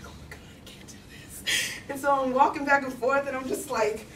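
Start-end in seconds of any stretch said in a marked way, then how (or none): 0.0-1.7 hushed, voiceless dread; panicked whisper. Retold or imitated. Girl terrified of needles holds a syringe, whispering in panic. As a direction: whisper it as if too scared to go on.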